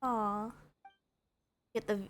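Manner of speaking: ordinary talk, nothing marked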